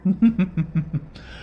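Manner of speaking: short mocking chuckle; sly laugh. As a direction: laugh at her cluelessness. Villain laughs at her. (கேலிச் சிரிப்பு)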